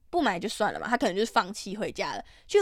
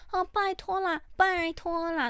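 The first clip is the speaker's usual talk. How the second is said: imitating a begging customer; 8 semitones higher, slower than usual.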